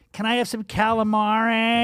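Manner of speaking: high, drawn-out mock Italian-American calamari order. Host mocks locals ordering squid, exaggerated Italian-American accent. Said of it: "all the Medigods say".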